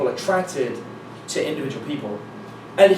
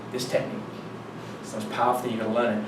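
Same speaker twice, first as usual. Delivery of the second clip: low, soft mock seminar speaker; hushed guru pitch; 7 semitones lower, 9 dB quieter, faster than usual. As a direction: imitate a speaker confiding a powerful secret. Mimics new speaker pitching a technique.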